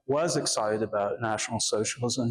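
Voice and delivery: normal talking voice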